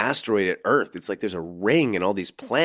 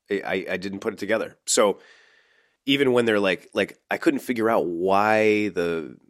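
usual speaking voice

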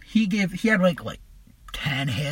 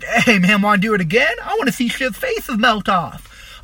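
louder, higher, hyped-up retelling; eager excitement; 3 semitones higher, 4 dB louder than usual. Retold or imitated. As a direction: pester a buddy, acting keen for another go. Acts out eagerness to trip again.

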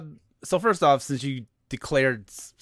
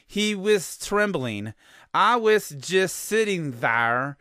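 slow, higher-pitched mock Scottish accent; careful, over-enunciated Scottish accent, drawn out; Scottish dialect reading; 6 semitones higher, 3 dB louder, slower than usual. Shown as a demonstration. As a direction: read it word for word in laboured Scots. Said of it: "like he enunciated every word".

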